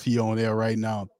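normal talking voice

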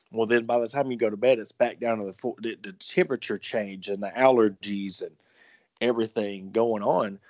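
usual speaking voice

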